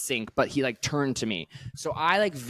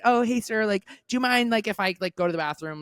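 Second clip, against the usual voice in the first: mock polite ask; 7 semitones higher than usual. Polite quote to stranger.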